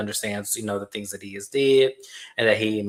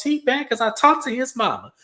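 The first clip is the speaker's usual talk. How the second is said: much higher imitated complaint; mock indignant girlfriend; 12 semitones higher than usual. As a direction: imitate her indignation up high.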